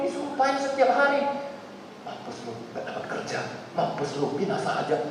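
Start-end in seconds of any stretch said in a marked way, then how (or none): 2.0-5.0 low, soft spiteful curse; muttered curse. Retold or imitated. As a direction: spit it out under the breath. Mengutuk suami. (kutukan pelan dan sinis)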